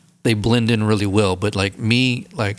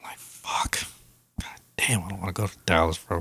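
whispered dread; 3 semitones lower, 8 dB quieter than usual. Real, not acted. Dreading a trip to Dallas.

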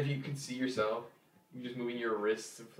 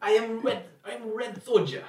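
higher, louder Russian soldier voice; Russian accent; 10 semitones higher, 7 dB louder than usual. Pretend. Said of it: "I, I got one".

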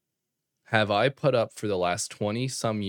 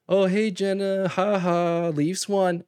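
mock teasing; 9 semitones higher, 3 dB louder, slower than usual. Uncle teasing niece on phone.

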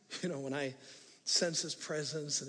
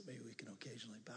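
whisper; 6 semitones lower, 18 dB quieter, faster than usual. Real, not acted. Hushed aside to congregation.

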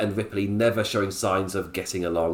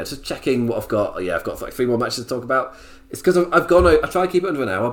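usual speaking voice

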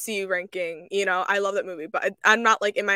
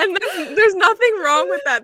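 higher, louder, through a laugh; 9 semitones higher, 6 dB louder than usual. Real, not acted. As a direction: brush it off with a laugh. Laughing reassurance, then repeated.

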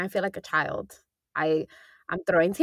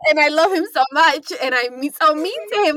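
higher and louder, bursting with affection; gushing; 8 semitones higher, 7 dB louder than usual. Real, not acted. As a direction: blurt out the love, then catch yourself laughing. Gushing about boyfriend, half laughing.

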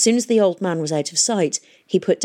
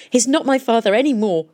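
raised, louder bitter declaration; acted bitterness, pitched up; 6 semitones higher, 5 dB louder than usual. A narrator's reading. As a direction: disown him with force. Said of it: "said Catherine bitterly".

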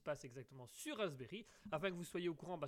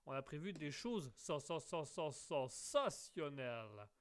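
stammered build-up, then a slow, stretched word; drawn-out sensationnelles; 2 semitones lower, slower than usual. Pretend. Host teasing listeners with a hammed-up, stretched sensationnelles. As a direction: stutter playfully before landing on sensationnelles slowly.

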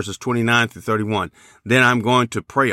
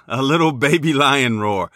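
ordinary talk, nothing marked

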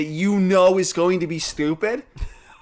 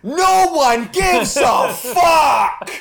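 high, slow, loud rant; ranting shout; 9 semitones higher, 4 dB louder, much slower than usual. Real, not acted. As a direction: yell it out slowly, fed up. Ranting about TikTok trends.

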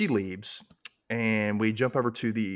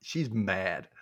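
ordinary talk

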